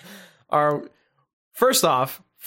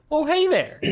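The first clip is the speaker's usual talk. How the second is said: mock Midwest greeting; 13 semitones higher than usual.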